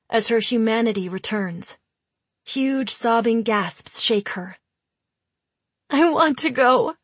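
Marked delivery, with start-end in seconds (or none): 5.9-7.0 tearful, quavering plea; quavering wet voice. A narrator's reading. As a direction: speak through the tears. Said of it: "in a quavering, wet voice".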